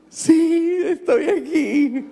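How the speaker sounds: high, laughing, caught-out answer; nervous laugh. Retold or imitated. Caught off guard on the phone.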